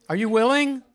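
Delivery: ordinary talk, nothing marked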